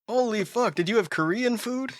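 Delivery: lightly voiced joking accusation; mock voice.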